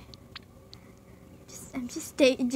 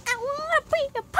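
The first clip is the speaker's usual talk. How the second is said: very high, louder, rushed squeal; silly squeal; 5 dB louder, faster than usual. Retold or imitated. Vlogger mocking unresponsive viewers, silly squeal. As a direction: squeal it like a cartoon outburst.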